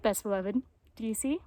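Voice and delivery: usual speaking voice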